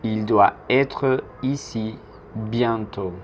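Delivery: slow French sentence, pausing between word groups; slow model. Shown as a demonstration. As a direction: model it slowly for learners to repeat.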